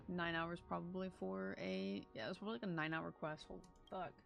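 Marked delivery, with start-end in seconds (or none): none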